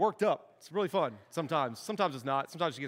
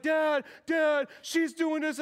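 put-on whiny kid calling dad; 8 semitones higher, much slower than usual. Little sister tattling to dad, shouting high. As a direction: call out like a kid tattling on a sibling. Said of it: "she's like".

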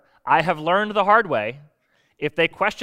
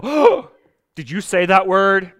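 high, loud scandalized cry, then slower; shocked gasp; 3 semitones higher, 7 dB louder, slower than usual. Retold or imitated. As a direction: gasp like a child who heard a cuss word. Daughter shocked at cuss word.

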